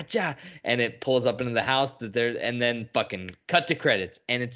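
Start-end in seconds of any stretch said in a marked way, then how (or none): none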